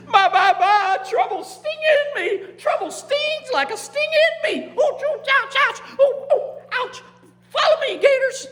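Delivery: very high, yelping character voice; panicked gator king. Acted. Gator king stung by fire calling his gators, high mock-frantic yelps. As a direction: squeal it in mock pain, then rally them. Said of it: "And the king of the gators said".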